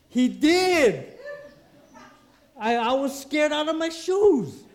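high, loud outburst; emphatic exclamation. Real, not acted. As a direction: belt it out in amazed alarm. Exclaims about his own calling.

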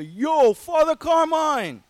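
loud, high Philadelphia street call; hammed-up Philadelphia shout; Philly Rocky voice. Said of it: "in his best Philadelphia".

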